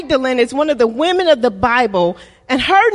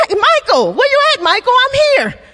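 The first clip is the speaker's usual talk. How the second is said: high-pitched searching call; mock calling out; 8 semitones higher, faster than usual. Preacher playing Jesus looking for Michael, mock high calling out. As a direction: call around for someone, voice climbing high.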